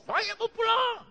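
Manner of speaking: shouted way up high, defiant; high retold shout. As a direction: yell it like a soldier storming out. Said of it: "Teriak dia di kelas". (teriakan tinggi penuh amarah)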